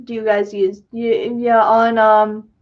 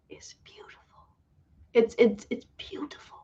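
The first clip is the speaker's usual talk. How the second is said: hushed unvoiced whisper of praise; whispered teaser, almost silent; secretive whisper; 10 dB quieter than usual. Real, not acted. Teasing a secret scene.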